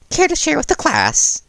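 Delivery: a curious, teasing principal; Nezu's voice. Acted. Nezu's voice, high far timbre.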